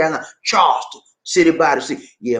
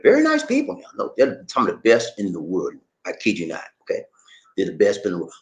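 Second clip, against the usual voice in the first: normal talking voice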